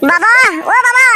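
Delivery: high yelled call across the house. Acted. Son shouting for his sleeping father. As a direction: shout for father from the other room.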